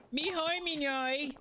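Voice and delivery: high silly Doodle Bob babble; Doodle Bob squeak. Retold or imitated. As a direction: squeal like a doodle come to life. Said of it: "That's doodle posting".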